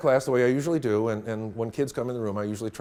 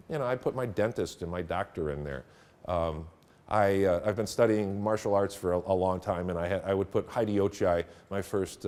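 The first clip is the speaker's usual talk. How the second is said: ordinary delivery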